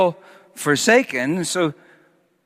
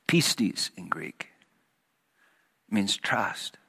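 hushed aside; 9 semitones lower, 10 dB quieter, slower than usual. Real, not acted. Softly explaining the Greek word.

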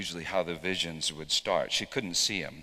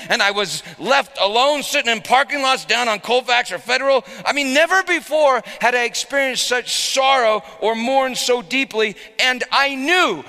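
angry outcry; 15 dB louder than usual. Retold or imitated. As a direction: let the anger pour out, rising to a shout. Preacher retells his own anger.

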